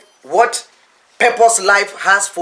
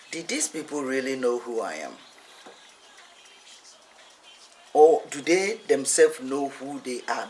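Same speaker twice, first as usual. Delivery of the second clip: quiet; 6 semitones lower, 7 dB quieter than usual. Acted. Jesus's inner question, voiced.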